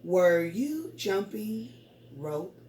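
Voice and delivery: slow, each word set apart; counting words. Shown as a demonstration. Teacher counting words with pupils, slow word-by-word. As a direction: pause before ROPE, one word at a time. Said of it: "All right, let's count".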